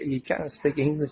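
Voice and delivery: normal talking voice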